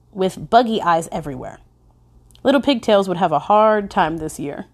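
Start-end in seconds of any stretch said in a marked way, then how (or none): none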